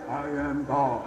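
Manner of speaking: deep, quiet movie God voice. Acted. Speaker showing how films voice God, deep God voice. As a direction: drop low and intone it as God. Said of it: "with a voice like this".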